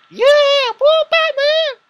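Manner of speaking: yüksek ve yüksek sesli coşkulu tezahürat; cheering. Retold or imitated. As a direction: sinemada Batman'a bağırıyormuş gibi coşkuyla. Cheering at the movie.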